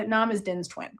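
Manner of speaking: usual speaking voice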